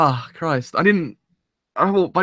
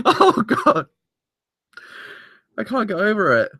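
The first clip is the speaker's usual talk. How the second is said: tearful; 6 semitones higher, slower than usual. Real, not acted. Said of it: "I didn't think I was gonna cry".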